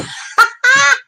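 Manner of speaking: high, loud burst of laughter; real cackle at a joke; big laugh. Real, not acted. Cracks up at a viewer's joke.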